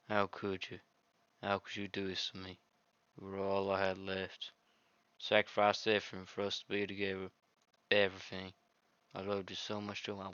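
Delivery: put-on quiet, wounded girl; softly read heartbroken heroine. The deleted heroine mourning to the player, soft and hurt. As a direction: let her plead quietly, hurt.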